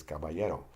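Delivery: caballero with LL said as English Y; double L as Y. Shown as a demonstration. Teacher showing the double L, Y sound in caballero. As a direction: glide the LL in caballero like a Y. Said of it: "pronounced like the Y in English".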